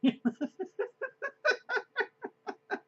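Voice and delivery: high hearty laughing fit; real burst of laughter; big laugh. Real, not acted. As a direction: laugh out loud at yourself. Teller laughing at mistake.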